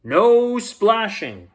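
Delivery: high, slow, loud command; lifeguard shout. Acted. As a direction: bark the rule out slowly. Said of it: "the loud whistle again".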